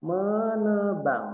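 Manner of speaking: very slow, stretched syllable by syllable. Shown as a demonstration. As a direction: draw each syllable out for learners. Key meaning spelled out slowly. (pelan, suku kata dipanjangkan)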